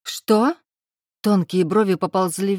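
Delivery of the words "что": higher, louder startled what; mother caught off guard by the question; surprised. A narrator's reading. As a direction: jolt of disbelief.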